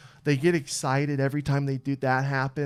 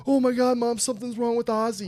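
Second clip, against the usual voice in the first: retold panic; 8 semitones higher than usual. Son alerting mom about the dog's fit, panicked. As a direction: relive the alarm, pitch jumping up. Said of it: "I actually kinda fucking panicked a little".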